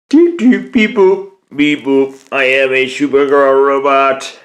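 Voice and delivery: high, slow beeping robot voice. Pretend. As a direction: play a toy robot booting up. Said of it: "She's doing the robot".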